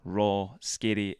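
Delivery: usual speaking voice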